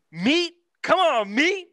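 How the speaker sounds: exasperated. Real, not acted. Quoting movie, exasperated.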